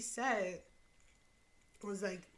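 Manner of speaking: talking while eating soup; words around a spoonful; mouth full. Real, not acted. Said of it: "I'm sorry, I'm eating my soup".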